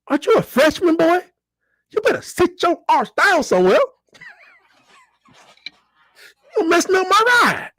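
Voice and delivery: high-pitched mock mother scolding her son; scolding mom. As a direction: play the fed-up mom telling the boy to sit down.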